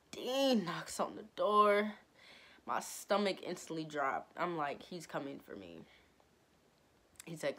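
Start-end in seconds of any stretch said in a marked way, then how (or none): 4.8-5.8 dreading mutter. Retold or imitated. Dread as the dean knocks.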